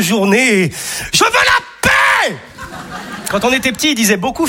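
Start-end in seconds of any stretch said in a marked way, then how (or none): 1.1-2.4 higher, slow mock yell of an exasperated dad; father yelling. A father yells through the house for peace, in a higher, slow mock yell. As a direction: bellow it like a fed-up father. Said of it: "on l'entendait gueuler dans la maison".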